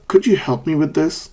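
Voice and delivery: high on "could you", dip, then up on "this"; polite question curling upward at "this"; fall-rise intonation. Shown as a demonstration. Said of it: "Fall, rise".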